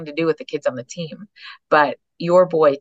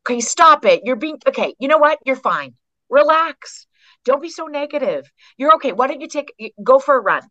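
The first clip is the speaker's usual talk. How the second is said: much higher, louder mock partner brushing off pain; imitating a pushy fixer; 10 semitones higher, 3 dB louder than usual. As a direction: play the impatient fixer who says relax. Voicing a partner who tries to fix.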